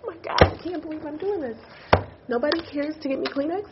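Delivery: high, tearful disbelief at oneself; crying. Real, not acted. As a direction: talk through tears, embarrassed. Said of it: "why am I crying?".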